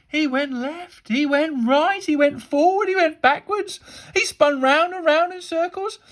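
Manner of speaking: escalating high, loud narration; excited commentary. A narrator's reading. Narrating the teacher's frantic chase.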